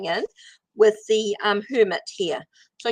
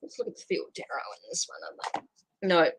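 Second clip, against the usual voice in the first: whispering; 4 semitones lower, 7 dB quieter than usual. Real, not acted. Whispered aside to herself.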